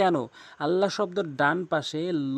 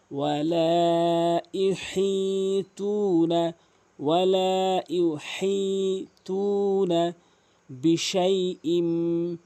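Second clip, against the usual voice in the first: slow melodic recitation; tajweed recitation; Quran recitation; 2 semitones higher, 4 dB louder, much slower than usual. Acted. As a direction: chant it slowly and evenly.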